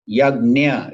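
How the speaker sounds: "yagna" as slow, loud "YAG-nya"; correct pronunciation. Shown as a demonstration. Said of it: "correct pronunciation".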